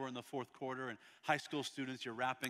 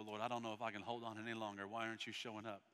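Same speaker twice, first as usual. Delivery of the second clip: low pleading prayer; 4 semitones lower, 7 dB quieter, faster than usual. Acted. Acting a struggling believer's prayer.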